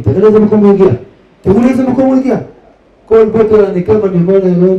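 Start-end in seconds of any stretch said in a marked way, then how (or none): 0.0-2.4 raised, emphatic exclamation; קריאה נרגשת. Real, not acted. מטיף מדגיש לאן הידרדר. (קריאה נרגשת ומודגשת)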